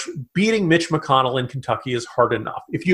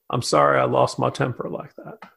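normal talking voice

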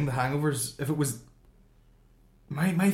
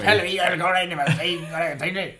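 a lightly voiced mumbler, a bit higher and slurred together; imitating a mumbling bar regular; 5 semitones higher than usual. Telling a mate about the inaudible man at the pub, mocking mumble. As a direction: do the guy whose words nobody can make out. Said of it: "that's exactly the way he talks".

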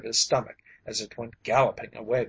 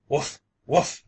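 gruff bear woofs, the second louder; 2 semitones higher, 4 dB louder than usual. A narrator's reading. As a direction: bark it out like a big bear. Bear answers Mister Penrose.

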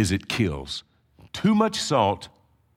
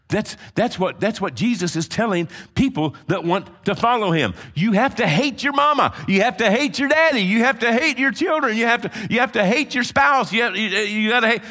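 raised, driving preacher's cadence; full-force preaching; 12 semitones higher, 3 dB louder than usual. Acted. Pastor rallying the congregation, preaching hard. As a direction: pound each phrase home from the pulpit.

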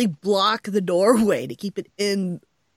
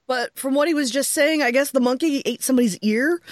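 ordinary delivery

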